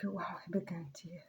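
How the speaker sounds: hushed unvoiced whisper; whispered thought. Recalling her own thought on the walk, whispered. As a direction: murmur it like a private shock.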